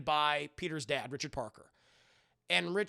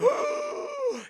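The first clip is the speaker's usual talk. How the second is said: sharp high gasp back to life; big mock gasp for air; revival gasp; 7 dB louder than usual. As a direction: gasp like waking from death. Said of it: "He's like".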